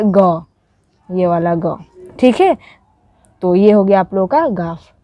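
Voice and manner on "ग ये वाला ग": ग said as a plain voiced ga; ग with a plain g, no throat sound. Shown as a demonstration. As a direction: say ग clearly, as in गमला. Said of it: "जिसका pronunciation होता है".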